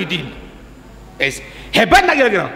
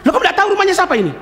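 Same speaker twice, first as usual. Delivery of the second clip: fast, higher rebuke; incredulous passerby; 8 semitones higher, much faster than usual. Retold or imitated. Passerby scolding the accuser, fast and higher. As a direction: rattle it off in disbelief.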